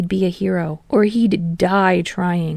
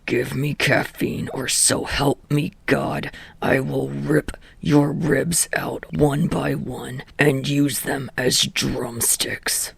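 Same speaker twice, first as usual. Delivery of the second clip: low, slow menacing snarl; 5 semitones lower, 5 dB quieter, slower than usual. A narrator's reading. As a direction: growl the threat under your breath. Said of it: "his words were only snarled".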